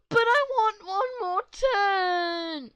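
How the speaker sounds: put-on high, loud, drawn-out bratty kid; whiny kid voice. Bratty kid begging to keep playing, loud whine. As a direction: whine it like a spoiled child. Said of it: "kid in story demands turn".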